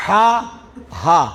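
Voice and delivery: the ہ sound alone; back-throat ha. Shown as a demonstration. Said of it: "یہ حلق کے اینڈ سے ہوتا ہے".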